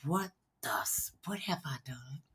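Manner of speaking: breathless horrified whisper under her breath; panicked whisper. Retold or imitated. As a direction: whisper it in dread at the altar. Panicked thoughts at the altar.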